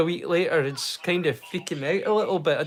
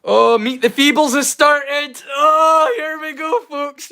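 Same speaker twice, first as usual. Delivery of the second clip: excited; 8 semitones higher, 5 dB louder, slower than usual. Real, not acted. Film starts, excited announcement.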